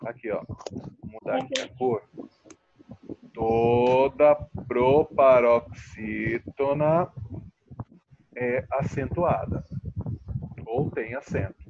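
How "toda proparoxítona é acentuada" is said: drawn-out delivery for pupils taking notes; slow dictation. Shown as a demonstration. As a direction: spell the rule out slowly, word by word. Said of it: "pode até anotar aí do ladinho". (ditado lento, bem pausado)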